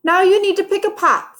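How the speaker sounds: chirpy teacher prompting the class; high, fast, cheerful teacher; Miss Kathy's voice. Acted.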